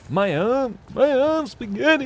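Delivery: very high-pitched rapper mimicry; weird rapper voice. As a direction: squeak it like an odd-voiced rapper. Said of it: "like, a weird voice for rapping".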